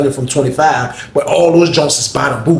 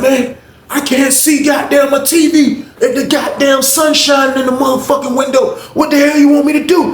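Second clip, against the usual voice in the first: retold angry yell; 11 semitones higher than usual. Cellmate yelling back about the sun on his TV, high indignant shout.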